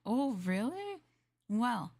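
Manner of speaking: ordinary delivery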